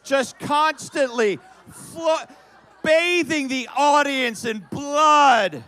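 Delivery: high, loud, slow laughing yell; excited shout. Real, not acted. Actor yells to the audience about spraying blood, laughing. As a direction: build the chaos into a laughing shout.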